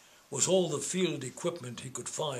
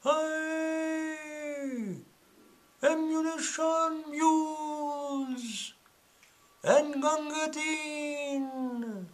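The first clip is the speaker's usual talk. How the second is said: high, slow calls as if from a distance; distant front-line shout; far-off shout; 13 semitones higher, much slower than usual. Acted. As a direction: cry out across the battlefield. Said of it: "you could hear the front files shout".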